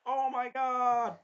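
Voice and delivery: drawn-out high gasp of shock; gawking oh my god. Retold or imitated. Melty gawking at the crown, slow high astonishment. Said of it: "and just be like".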